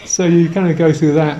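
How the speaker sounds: usual speaking voice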